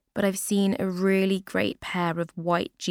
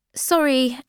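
nervous high sorry; 7 semitones higher, 6 dB louder than usual. Acted. Becky bluffing a shop customer.

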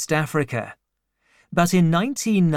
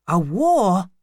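higher, louder eager cry; excited cry; 5 semitones higher, 4 dB louder than usual. A narrator's reading. Servant boy hears war is coming, excited cry. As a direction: blurt it out thrilled at the news. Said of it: "he cried excitedly".

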